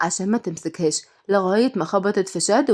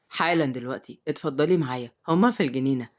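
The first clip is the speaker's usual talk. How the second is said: low, soft male character voice; deep-voiced host; obliging uncle; 6 semitones lower, 3 dB quieter than usual. A narrator's reading. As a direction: invite politely in a lowered voice. Uncle leads nanny to garden.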